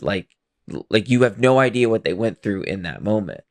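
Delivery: ordinary talk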